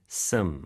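"some" with the vowel reduced almost to "s'm"; fast reduced some. Shown as a demonstration. Co-host showing fast speech, reduced "some". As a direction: squeeze "some" short, as in quick speech. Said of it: "진짜 빨리 말할 때".

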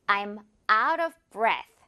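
very slow, stress on of; slow model sentence. Shown as a demonstration. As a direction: model line, every word separated. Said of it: "이런 문장 한번 볼게요". (아주 천천히 읽어주는 예문)

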